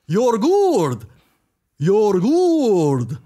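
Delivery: hammed-up Dracula insisting; mock Dracula. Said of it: "this is Dracula".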